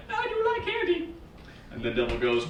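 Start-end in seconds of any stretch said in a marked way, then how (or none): 0.1-1.1 put-on high, small little-kid voice; squeaky childlike interest; child Jesus. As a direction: admit it like a tempted child. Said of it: "And Jesus is like".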